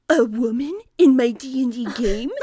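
gasping, loud awkward gamer; shocked nerd voice. Retold or imitated. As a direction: blurt it like a stunned nerd.

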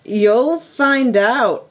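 put-on slow, drawn-out stab at a Trump accent; attempted Trump. Pretend president answering the reporter, badly faked Trump accent. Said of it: "I can't do a Trump accent".

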